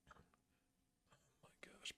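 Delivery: very slow, barely-there whisper; dismayed whisper. Real, not acted. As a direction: whisper it in disbelief. Dismayed at player's mistake.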